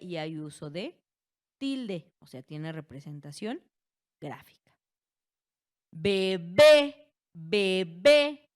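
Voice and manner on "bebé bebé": "Bebé" with the second "bé" much louder; stress on last syllable. Shown as a demonstration. Teacher giving another example of acute words, final stress on "Bebé". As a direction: hit the final "bé" hard, both times, in "Bebé". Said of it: "Su sílaba tónica recae al final".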